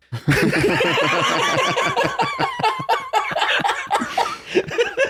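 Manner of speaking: huge wheezing laugh, very high; real burst of laughter, high pitched. Real, not acted. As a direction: crack up hard. Big laugh at punchline.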